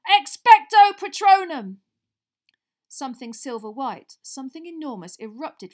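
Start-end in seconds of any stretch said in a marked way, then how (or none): 0.1-1.8 loud, slow roared spell. A narrator's reading. Harry roaring the spell at Dementors, loud roar. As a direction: bellow the spell with everything.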